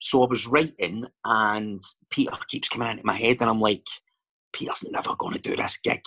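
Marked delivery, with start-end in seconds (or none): 4.5-6.0 hushed, half-whispered inner thought; muttered self-doubt. Retold or imitated. Writer doubting the casting, hushed muttering to self. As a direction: murmur it to yourself, voice dropped away.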